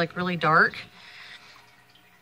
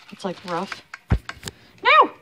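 usual speaking voice

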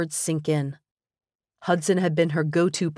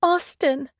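high, trembling name held back from crying; fighting back tears; repressed tears; 11 semitones higher than usual. A narrator's reading. Woman on the phone to her friend, holding back tears. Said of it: "body shuddering with repressed tears".

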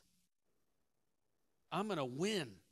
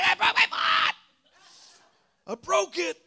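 high, loud, anguished yell; pained shout; 12 dB louder than usual. Retold or imitated. Injured player yelling to his friend that his foot is broken. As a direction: cry it out in agony.

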